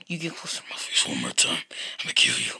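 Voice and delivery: low whispered threat; a mother quietly threatening her son; menacing whisper. Acted. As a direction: hiss the threat softly, close and cold.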